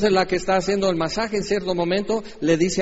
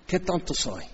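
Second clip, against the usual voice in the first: sheepish self-reproach; 8 dB quieter than usual. Retold or imitated. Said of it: "estás diciendo".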